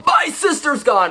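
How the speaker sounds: high, hammed-up panicked cry; mock panic. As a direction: gasp it out as a fake-out alarm.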